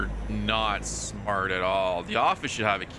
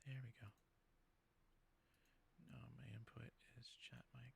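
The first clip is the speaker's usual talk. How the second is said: soft private whisper while fixing settings; quiet whispered note to self; whispered aside; 34 dB quieter, much faster than usual. Real, not acted. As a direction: whisper it to yourself, off mic. Muttering to himself over settings.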